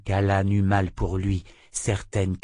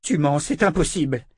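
higher, agitated denial; outraged cry; 7 semitones higher, 3 dB louder than usual. A narrator's reading. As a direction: protest hotly, voice raised in pitch. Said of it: "s'écria le sourcier".

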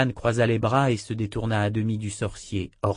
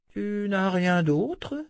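higher, slow, hungry question; greedy old wizard; 10 semitones higher, much slower than usual. A narrator's reading. Old wizard still hungry asks Calan for more food.

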